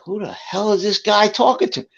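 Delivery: high-pitched, mildly indignant disbelief; baffled retold reaction.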